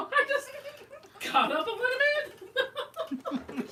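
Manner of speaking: words broken by laughter; laughing. Real, not acted. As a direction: laugh through the line. Laughing through the story.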